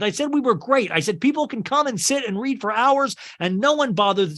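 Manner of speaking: high, heated retelling of an outburst; blowing up at being misquoted; outraged. Retold or imitated. Joe outraged after a TV interview, raising his voice in pitch. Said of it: "And Joe, uh, outraged".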